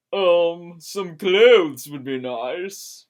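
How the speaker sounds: loud, slow, pointed demand; pointed request. Acted. Kate pointedly asking Caitlin for clothes, loud and slow. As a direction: press for it slowly and pointedly.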